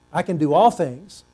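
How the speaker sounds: normal talking voice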